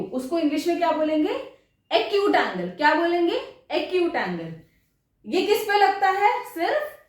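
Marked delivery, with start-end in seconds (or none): none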